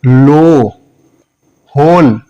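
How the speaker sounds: लो, होल with a held round "oh"; long O. Shown as a demonstration. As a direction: round and stretch the vowel in लो and होल. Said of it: "long sound में होता है". (लंबा ओ)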